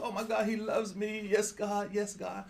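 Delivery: light, breathless mock gush; a hint of an overjoyed believer; gushing inner voice. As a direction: play the giddy voice that thinks God loves it. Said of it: "there is the voice that goes".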